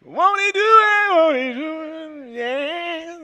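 high, sung preacher hoop. Pretend. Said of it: "I should just hoop my name".

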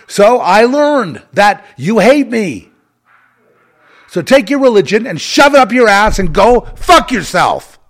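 loud, slow, seething yell; furious shout. Real, not acted. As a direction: spit it out in full fury. Furious rant at Christians.

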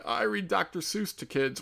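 a hint of mock-sweet primary teacher; second grade teacher. Woman with second grade teacher vibes, lightly imitated. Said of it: "second grade teacher vibes".

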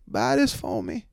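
high, slow put-on woman's voice; high-pitched accented shopper imitation; imitating woman customer. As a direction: mimic her accented request, drawn out. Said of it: "She goes".

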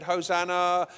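mild crowd shout; imitated shout. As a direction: call it like the crowd. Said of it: "They shouted".

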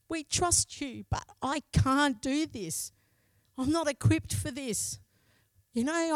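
high-pitched, pleading prayer through held-back tears; retold tearful plea. Pastor's wife crying out to God on the drive home, tearful plea. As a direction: plead upward, as if near sobbing. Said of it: "just sobbing and saying to God".

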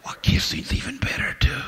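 cheeky whispered aside. Real, not acted. Pastor teasing the congregation about kissing, cheeky whisper. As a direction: lean in and whisper it like a secret.

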